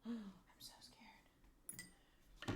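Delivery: slow, gasped, breathy whisper of fear; scared whisper. Real, not acted. Whispering to chat while fitting clock, real fear. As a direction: hold your breath and barely voice it.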